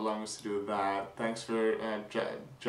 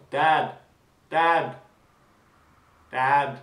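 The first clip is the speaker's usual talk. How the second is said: loud, raised-pitch imitated calls, spaced apart; repeated calling out; 4 semitones higher, 10 dB louder, much slower than usual. Son calling his dad who didn't respond, imitated shouting. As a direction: keep calling for someone who isn't responding. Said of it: "called out to his dad".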